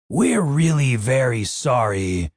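slow, nervous apology; shaking voice. A narrator's reading. Dave apologises to the minotaur, voice shaking. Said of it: "Dave's voice shook as he spoke".

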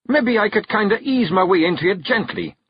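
high Scots wee-man voice, protesting; a tiny Scottish warrior wriggling out of it; protesting Feegle. Acted. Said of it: "he protested".

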